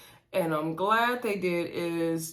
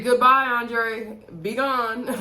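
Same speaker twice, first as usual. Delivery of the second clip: slow, loud, higher sendoff; sassy goodbye; 4 semitones higher, 6 dB louder, slower than usual. Pretend. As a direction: wave him off with a drawn-out goodbye. Dismissing Andre at the TV.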